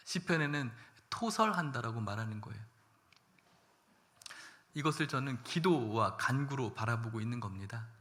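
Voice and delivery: ordinary delivery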